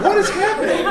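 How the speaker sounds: high, loud, laughing panic; retold panic, loud and high with a laugh; alarmed cry. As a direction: squeal it in mock alarm. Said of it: "And I'm going".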